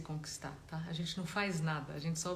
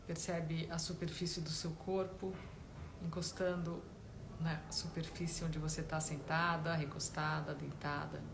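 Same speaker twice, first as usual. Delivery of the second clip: guided meditation; 7 dB quieter than usual. Real, not acted. Meditation teacher guiding listeners to notice body and breath, calm guided-meditation voice. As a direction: gently guide them inward, leaving space between phrases.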